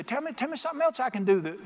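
eager mock request; excited eagerness. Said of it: "you're gonna get excited about the kingdom".

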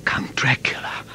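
breathless, voiceless whisper of dread; hushed terror, barely a breath; frightened whisper. Acted. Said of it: "Jonathan whispered".

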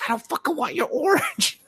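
very high squealed refusal, breaking into laughter; mock exasperation. Girl refusing the drunk man's orange, exasperated. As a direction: refuse in hammed-up outrage.

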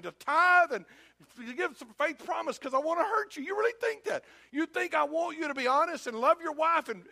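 high, forceful, incredulous reproach; indignant disbelief. Acted. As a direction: challenge them hotly, pitch climbing high. Wounded father escalating into indignant challenge.

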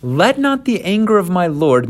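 high, pleading excuse-maker; defensive Aaron. Acted. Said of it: "And Aaron says this".

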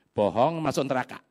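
usual speaking voice

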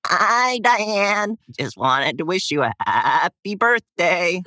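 high, slow bleating goat voice. Shown as a demonstration. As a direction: talk like a goat sending birthday wishes. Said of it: "we dub a message over it in a goat voice".

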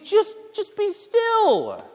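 exasperated mock imitation; mocking annoying advice. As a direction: mimic the person who grates on you. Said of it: "someone just tells me".

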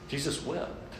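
softly, almost breathed; hushed Jesus wept. Real, not acted. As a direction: let the verse fall very quietly. Pastor repeats verse softly.

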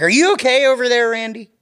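high, loud, far-off mock concern; hammed-up fake concern; mock concerned call-out. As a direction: tease him like a worried mom. Teasing an audience member.

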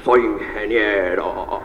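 slow, low, pompous drawl; puffed-up low voice, words stretched out; self-important engineer. Acted. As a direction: announce it with mock grandeur. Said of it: "una persona muy importante".

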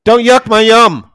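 high, loud, slow and emphatic; indignant kid throwing the family saying back; imitated kid's retort. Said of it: "He's like".

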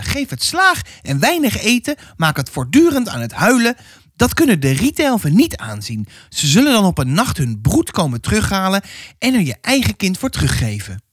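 put-on high, pressing old woman's voice; stern old neighbour. Old neighbour warning the mother about her child, high and pressing. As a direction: lean in like a suspicious village gossip.

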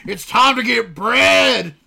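loud, high, drawn-out crowing yell; hammed-up shout. Mom imitating her daughter's crude joke, hammed-up shout. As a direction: bellow it like a proud announcement. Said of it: "starts thrusting midair, going".

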